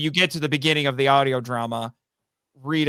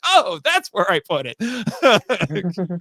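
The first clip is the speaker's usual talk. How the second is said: realizing surprise; 4 semitones higher, 3 dB louder, much slower than usual. Retold or imitated. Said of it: "I was like".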